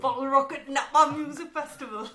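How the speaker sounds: laughing bad attempt at Sunderland accent; a fumbled Sunderland accent that slips toward Welsh. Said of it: "Was that Welsh?".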